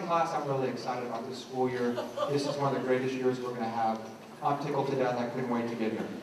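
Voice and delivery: low, soft monotone claiming excitement; unenthusiastic teacher. Shown as a demonstration. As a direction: drone it with no energy whatsoever. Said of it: "How much excitement, how much enthusiasm".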